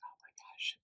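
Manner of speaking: breathless whispered wonder; awed whisper. Real, not acted. Reviewer whispering to viewers over a delicious scent. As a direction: breathe it out in awe. Smelling butterscotch, breathless awe.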